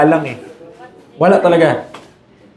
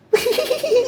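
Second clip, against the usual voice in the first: squeaky little giggle; high child's giggle before begging; 6 dB quieter than usual. Acted. Preacher laughs into child voice.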